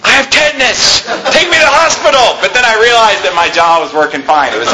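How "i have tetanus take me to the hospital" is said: high, laughing fake alarm; half-laughing about the dream panic; laughing mock panic. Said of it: "I literally was about to say to my wife".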